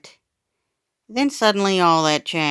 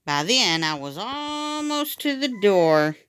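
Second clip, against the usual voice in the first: plain reading voice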